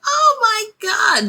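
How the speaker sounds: very high, slow, starstruck gasp of a line; swooning. Retold or imitated. Fan swooning after a wrestler says her name goodnight, high and slow. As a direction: melt like a fan who just got noticed. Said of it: "And I was just like".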